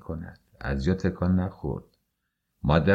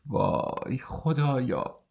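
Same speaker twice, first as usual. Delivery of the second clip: despairing cry; 6 semitones higher, 5 dB quieter, much slower than usual. A narrator's reading.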